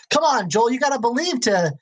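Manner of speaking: high, eager pleading; excited. Acted. Excited crewman urging his friend to believe, eager. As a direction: coax him with excitable insistence. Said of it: "the excited one".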